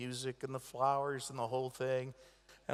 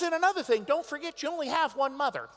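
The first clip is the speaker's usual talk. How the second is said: mock mom complaining; 11 semitones higher, 5 dB louder than usual. Mother voiced, scolding.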